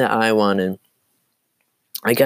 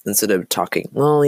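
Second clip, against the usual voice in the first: normal talking voice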